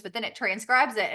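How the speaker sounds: ordinary delivery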